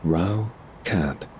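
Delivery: usual speaking voice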